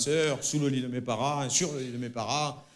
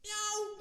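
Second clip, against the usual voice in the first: cat meow; 10 dB quieter than usual. Acted. Cat meows in the dark.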